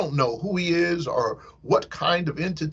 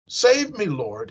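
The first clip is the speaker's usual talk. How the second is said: high, sudden cry for help; sinking Peter shouts to Jesus for rescue; shouted plea; 6 semitones higher than usual. Acted. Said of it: "he shouted".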